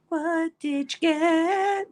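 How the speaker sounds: very slow, high, stretched-out words; singsong-slow, high mock demand; drawn-out nosy question. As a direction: playfully drag every word out, pitched high. Same question repeated, stretched out playfully.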